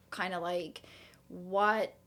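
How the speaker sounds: ordinary talk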